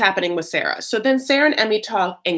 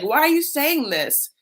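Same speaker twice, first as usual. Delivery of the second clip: higher, slower put-on bewilderment; mock protest; 8 semitones higher than usual. [name] answering his accusing wife, mock protest. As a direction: plead innocence in an imitated voice.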